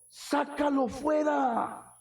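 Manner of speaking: slow, emphatic preacher's command; drawn-out call to throw it out. Real, not acted. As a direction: cast it out, slowly and firmly. Preacher commands casting out doubt.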